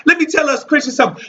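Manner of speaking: ordinary delivery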